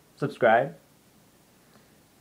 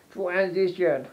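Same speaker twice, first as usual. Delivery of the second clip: high, playful announcer word; mock announcement; 8 semitones higher, slower than usual. Telling viewers the video cuts here, high playful announcing. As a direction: announce the cut like a title card.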